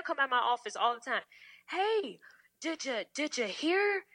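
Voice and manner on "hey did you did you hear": a hint of a chummy coworker; lightly mimicked coworker.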